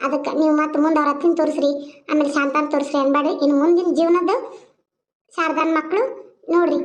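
ordinary talk

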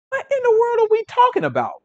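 very high, disbelieving outburst; a cry of pure disbelief; incredulous. Real, not acted. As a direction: throw it up in disbelief.

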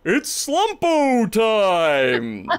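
high, loud, long-drawn announcement; cartoon showman catchphrase. Blue elephant steps out with hat and cane, bellowing grandly. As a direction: stretch out the big entrance line.

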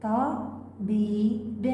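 طبيب drawn out very slowly; very slow read of طبيب; slow blending. Shown as a demonstration. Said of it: "منونة بتنوين الكسر،".

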